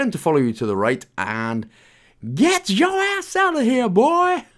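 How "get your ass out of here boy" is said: squeaky high-pitched shout, drawn out; high mock yell. Sudden shouted aside at character.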